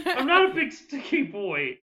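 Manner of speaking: high, loud whine through laughter; protesting monster. Acted. Game master protests as Stinky Boy.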